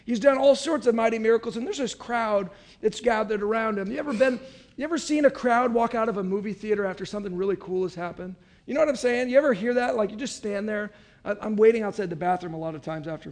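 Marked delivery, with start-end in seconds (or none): none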